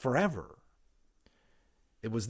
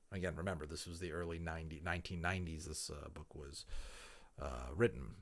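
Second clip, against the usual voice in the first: quieter, chatty explaining aside; aside to listeners; 7 semitones lower, 13 dB quieter than usual. Real, not acted. Reader pausing the book to explain history to listeners, low-key aside. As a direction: step out of the story and explain casually.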